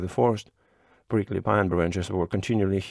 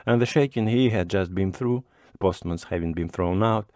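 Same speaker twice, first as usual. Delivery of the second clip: plain reading voice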